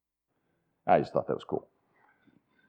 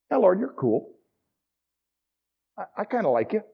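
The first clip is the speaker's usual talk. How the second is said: casual Peter; 7 semitones higher than usual. Retold or imitated. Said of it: "So it's kinda like this".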